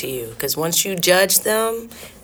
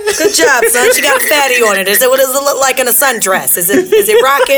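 high, loud, laughing bro; hyped bro dad; 5 semitones higher, 5 dB louder than usual. Pretend. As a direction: cheer the son on, laughing.

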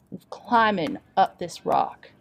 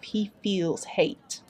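ordinary delivery

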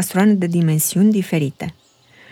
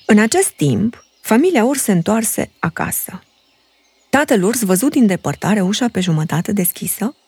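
ordinary delivery, nothing marked

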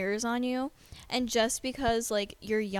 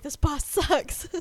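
laughing mock complaint; 4 semitones higher, 3 dB louder, slower than usual. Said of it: "and we're like".

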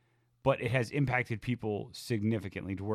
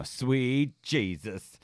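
very slow, stretched exclamation; drawn-out quote; 2 semitones higher, much slower than usual. Acted. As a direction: draw out each word like a catchphrase.

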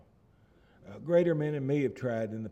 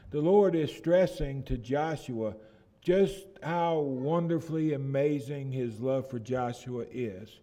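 ordinary talk

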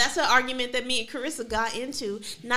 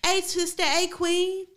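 hailing stranger; 7 semitones higher, 5 dB louder, slower than usual. Retold or imitated. Imitates strangers hailing women.

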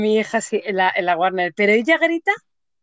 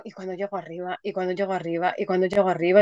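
starts barely audible and swells to normal level, lower pitch; anxious inner voice, building; 4 semitones lower, 5 dB quieter, faster than usual. Retold or imitated. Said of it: "es como".

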